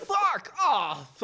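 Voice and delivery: high, loud mocking brush-off; mock grumpy vet. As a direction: squeal it as a cranky old man. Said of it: "It's like".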